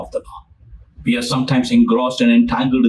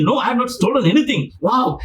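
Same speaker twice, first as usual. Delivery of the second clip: high, relieved and eager; relieved excitement; 8 semitones higher than usual. Retold or imitated. Rich man thanking Jesus, high and gushing. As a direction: gush with relief. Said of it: "He was very excited".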